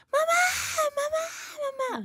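screaming. Retold or imitated. Terrified girl screaming for her mother. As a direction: cry out for mom in panic. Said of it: "empezó a gritar bien fuerte".

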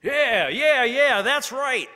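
rowdy crowd roaring yes; the boys roar approval of the whipping; cheering agreement. A narrator's reading.

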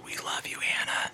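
whispered into the mic; a hushed teasing whisper. Acted. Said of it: "Why are you whispering into the microphone?".

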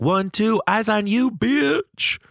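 high sassy yelled comeback; yelled reply. Retold or imitated. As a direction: shout it back high and sassy. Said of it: "I'm gonna yell back".

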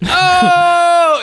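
piercing high shouted "Oh!"; high mock yelp. Telling friends how [name] reacted, shrill yelp. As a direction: yelp it like an offended diva. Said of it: "Does he do the".

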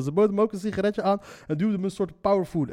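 ordinary delivery

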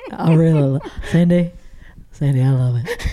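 low, lightly drawled Tennessee voice; Tennessee accent. Retold or imitated. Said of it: "Yours is terrible".

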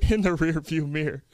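ordinary delivery

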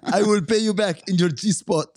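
put-on high comic foreign accent; Maurice. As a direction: threaten in a put-on accent, half laughing. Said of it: "Maurice from Tiptoes".

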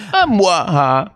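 short, high mock-vampire "mwah-ah"; brief cartoon vampire laugh; Dracula judging laugh. Dracula reacting to another joke, curt mock-evil laugh. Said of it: "you tell another joke. He goes".

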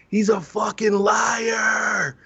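high, drawn-out shout; retold yell across a crowd. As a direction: re-enact yelling over a crowd, stretching the words. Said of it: "then I yell across".

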